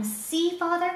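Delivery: high excited call to father; boy runs to father, calling out; crying out. Acted.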